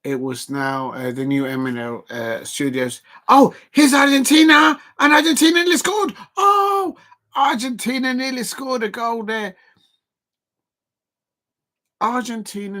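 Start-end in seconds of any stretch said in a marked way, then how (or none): 3.3-7.0 loud, high burst of excitement; excited shout. Real, not acted. Excited reaction to a near goal. 7.3-9.6 excited. Real, not acted. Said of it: "repeated calmly right after".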